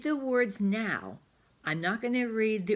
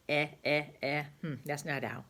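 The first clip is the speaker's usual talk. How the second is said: ordinary talk, nothing marked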